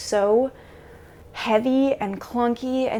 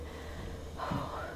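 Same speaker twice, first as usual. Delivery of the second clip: heavy sigh; 14 dB quieter than usual. Real, not acted. Overwhelmed vlogger.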